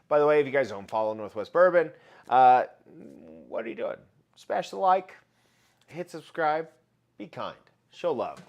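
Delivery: normal talking voice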